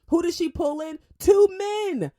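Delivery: high, loud punchline answer; emphatic exclamation. Real, not acted. Radio host driving home her point about the mayor, loud and emphatic. Host's indignant rhetorical question and answer.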